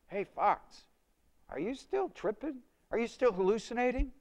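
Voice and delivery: high, teasing partygoer voice; mocking taunt. Party friends mock a tripping friend, high teasing taunt. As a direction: needle him about tripping.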